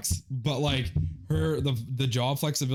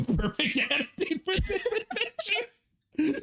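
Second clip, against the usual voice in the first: laughing through quote; slower than usual. Retold or imitated. Quoting friend in a chat story.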